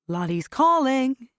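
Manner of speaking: high, loud and drawn out; singsong as if calling a friend; sing-song call. Acted. Haley excuses herself to Archie, high sing-song.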